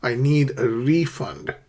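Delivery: slow model sentence, "refund" as REE-fund; "refund" said slowly with front stress, REE-fund; stress on first syllable. Shown as a demonstration. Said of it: "stress the first syllable".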